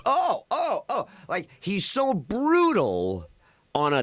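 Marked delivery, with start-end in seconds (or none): 0.0-1.1 shocked gasps. Retold or imitated. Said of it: "everybody at the table goes".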